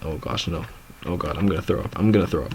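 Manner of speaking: low, quiet queasy muttering; queasy dread. Retold or imitated. Teller replays his sick thoughts on the scooter, queasy and low. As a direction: mutter it, imitating someone about to vomit. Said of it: "I started feeling so sick".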